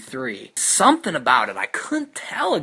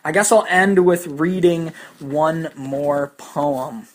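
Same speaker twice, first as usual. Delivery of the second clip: ordinary delivery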